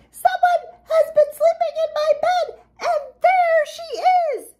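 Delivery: very high, squeaky cub outcry; squeaking baby bear. A narrator's reading. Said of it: "and squeaked".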